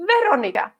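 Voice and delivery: "Veronica" stressed high on the first syllable; front-stressed "Veronica" with a round "o"; Czech pronunciation. Shown as a demonstration. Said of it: "like your Portuguese or, so it's".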